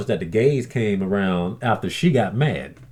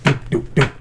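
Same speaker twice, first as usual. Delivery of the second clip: footstep noises; 3 semitones higher than usual. Retold or imitated. Imitating footsteps walking out.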